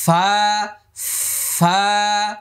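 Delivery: airy ফা held one beat; airy letter demo. Shown as a demonstration. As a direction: breathe out ফা between teeth and lip. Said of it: "এরকম করলে ফা বলতে পারবেন না".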